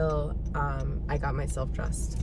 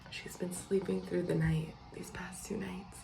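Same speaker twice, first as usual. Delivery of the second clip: whispering; 8 dB quieter than usual. Real, not acted. Baby asleep nearby.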